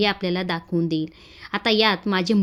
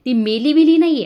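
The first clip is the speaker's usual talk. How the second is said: loud, higher scornful jab; taunting shout; 7 semitones higher, 8 dB louder than usual. Acted. Shilpa taunting the crying Chhaya. (तुच्छतेने मोठ्याने ओरडून)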